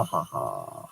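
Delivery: ordinary talk, nothing marked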